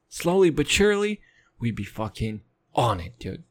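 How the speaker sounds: normal talking voice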